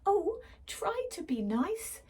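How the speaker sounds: high pleading mock whimper; whimpering plea. Lion pleads with the mouse, high whimper. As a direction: plead up high, hammed-up fright. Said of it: "he whimpered".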